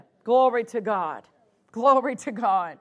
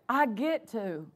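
grateful attitude; 3 semitones lower, 6 dB quieter than usual. Shown as a demonstration. Preacher models a servant's attitude to church, softly grateful. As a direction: speak it like a quiet, thankful vow. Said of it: "That needs to be our attitude".

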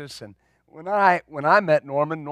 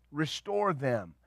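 soft echo; 3 semitones lower, 10 dB quieter, slower than usual. Shown as a demonstration. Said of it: "repeats the quote".

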